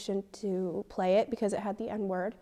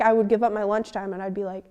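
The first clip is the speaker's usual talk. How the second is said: usual speaking voice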